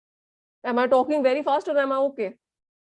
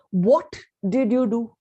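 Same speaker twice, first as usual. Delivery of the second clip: WHAT punched, then the rest slower; stress on WHAT; slower than usual. Shown as a demonstration. Teacher showing any word can be stressed, stress on WHAT. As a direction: hit "What" hard and hold back "did you do". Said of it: "Every word can be stressed upon".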